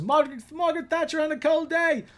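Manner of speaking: high mock British voice; Austin Powers quote. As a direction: ham up the Austin Powers line. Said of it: "like when Austin Powers is".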